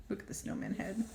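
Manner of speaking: hushed whispered aside. Real, not acted. Pointing out the snowman head to kids, whispering. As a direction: whisper it like a secret.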